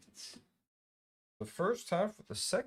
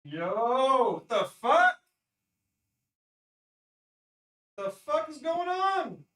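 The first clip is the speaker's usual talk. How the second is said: high, loud, exasperated yelling; real anger, pitched up and loud; furious outburst; 8 semitones higher, 6 dB louder, slower than usual. Real, not acted. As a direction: blow up at the power cutting out. Streamer's power keeps cutting out.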